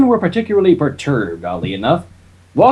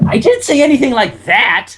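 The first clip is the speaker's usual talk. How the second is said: lightly voiced Spike; 7 semitones higher, 3 dB louder than usual.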